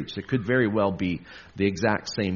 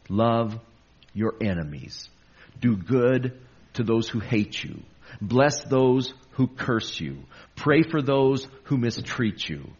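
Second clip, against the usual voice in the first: reading scripture. Acted. Rereads the verses.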